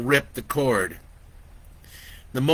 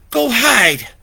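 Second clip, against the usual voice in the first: high, loud urgent command; urgent order; 12 semitones higher, 10 dB louder than usual. Acted. Vicky orders Lori to hide, urgent and loud. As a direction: push her to hide now.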